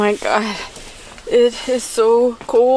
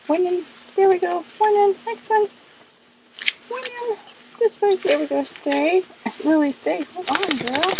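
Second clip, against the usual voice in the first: high sweet dog-talk voice; 10 semitones higher, 4 dB quieter than usual. Real, not acted. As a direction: coo at the dog. Dressing the dog in jacket.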